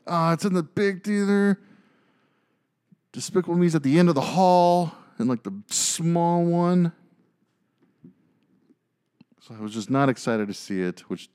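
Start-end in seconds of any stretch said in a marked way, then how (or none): 0.1-6.9 envious lament. Retold or imitated. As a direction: moan it high, stretching the last words.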